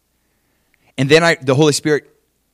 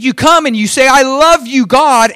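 loud, high, exaggerated praise; hammed-up adoring cry to God; mock-fervent worship; 11 semitones higher, 7 dB louder than usual. Preacher mimics someone praising God after cursing, mock-fervent. Voicing James mocking hypocritical worship.

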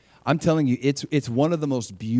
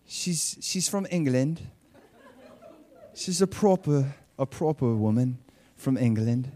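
attempted English accent; 3 dB quieter than usual. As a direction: put on a proper English accent, not very well. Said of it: "I don't do a good English accent".

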